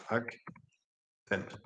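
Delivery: Akzent said the Swiss German way, very soft; a light Swiss German accent on Akzent, almost under the breath. Shown as a demonstration. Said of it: "in der Schweiz ... sprechen die Leute Akzent".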